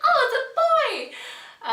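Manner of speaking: high, loud, slow laughing gasp; delighted realization. Retold or imitated. Retelling the moment at the scan, delighted realization. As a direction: let it dawn on you, laughing. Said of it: "I was like".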